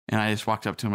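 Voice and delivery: usual speaking voice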